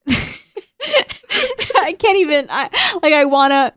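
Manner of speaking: breaking up with laughter, very slow; can barely talk for laughing. Real, not acted. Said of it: "it's too funny".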